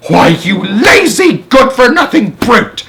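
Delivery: high, loud furious shout. Acted. Spiker shouting at James for asking, loud and furious. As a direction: shout the insult at him. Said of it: "Aunt Spiker shouted".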